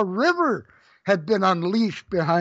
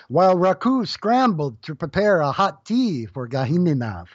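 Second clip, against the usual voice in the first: plain reading voice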